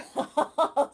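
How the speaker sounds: big real laugh out loud; loud burst of laughter. Real, not acted. Host laughing out loud at Steph's comment.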